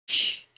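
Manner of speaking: long hushing "sh" on its own; SH sound. Shown as a demonstration. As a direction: isolate the "sh" sound. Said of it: "esse símbolo representa o som". (som de "sh" isolado)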